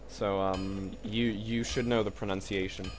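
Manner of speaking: usual speaking voice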